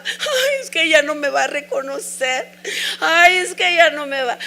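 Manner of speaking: reliving the tears, voice jumping high and loud; a tearful lament for the husband; retold crying. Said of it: "hasta eso lloraba". (reviviendo el llanto, agudo y quebrado)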